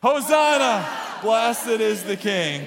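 loud, drawn-out group chant; leading a yell. Shown as a demonstration. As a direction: lead the crowd in shouting it. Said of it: "you yell".

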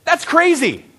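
high-pitched burst of disbelief; incredulous exclamation. Shown as a demonstration. Preacher giving congregation a line for their neighbor, incredulous. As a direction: exclaim it high, falling off sharply.

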